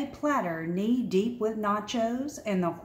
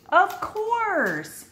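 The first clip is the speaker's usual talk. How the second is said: sudden bright realization, pitched up; an aha moment; high, eager of course; realizing; 9 semitones higher, 3 dB louder than usual. A narrator's reading.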